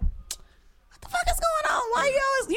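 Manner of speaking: high-pitched, exasperated demand; retelling an exasperated outburst, voice shooting up; irate confrontation. Retold or imitated. Woman confronting her ex over his attitude, irate and high-pitched.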